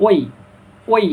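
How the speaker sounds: "ওই" gliding from rounded o to released i; round then release. Shown as a demonstration. Teacher demonstrates the diphthong, rounded-then-released "ওই". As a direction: round the lips for "ওই", then let them spread. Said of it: "প্রথমে গোল করে পরে ছাড়ে দিব".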